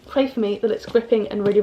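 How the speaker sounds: normal talking voice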